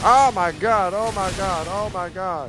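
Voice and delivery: high panicked oh my gods, fading; scared horror game streamer. Real, not acted. Attacked again in game.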